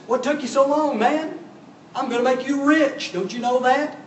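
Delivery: high-pitched, impatient mock complaint; impatient Balak. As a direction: act out a king pestering his late guest. Impatient Balak greets late Balaam.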